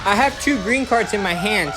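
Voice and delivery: ordinary talk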